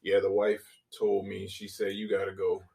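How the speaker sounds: normal talking voice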